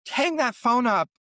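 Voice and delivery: high-pitched retold urgent order; urgent protest. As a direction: order them off the phone, mock-alarmed. Retells urgently stopping the ambulance call.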